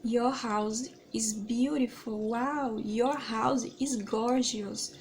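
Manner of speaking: "Your house is beautiful" drawn out slowly, admiring; slow, clear compliment to a host; slow English demo. Shown as a demonstration. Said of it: "em inglês vai ficar".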